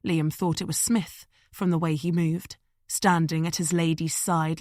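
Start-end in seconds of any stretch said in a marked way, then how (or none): none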